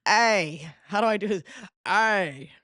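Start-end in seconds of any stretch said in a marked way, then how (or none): 0.1-0.7 loud, lightly mimicked rapper's call; imitated rapper ad-lib. Fan trying out a rapper's sound, loud mimicked ad-lib. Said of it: "That's what he sounds like". 1.8-2.5 loud imitated rapper's "ay". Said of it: "That's what he sounds like, right?".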